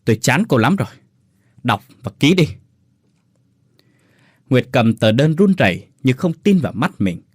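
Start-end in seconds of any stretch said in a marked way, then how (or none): 0.0-2.5 curt, cold snarl; husband gruffly dismissing wife; gruff contempt. Acted. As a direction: bite each word out coldly. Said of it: "rồi cằn giọng".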